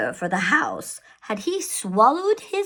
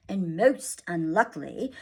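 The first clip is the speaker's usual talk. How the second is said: plain reading voice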